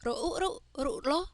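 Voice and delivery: teacher's sing-song spell-and-read drill. Shown as a demonstration. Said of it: "let's practice reading these words".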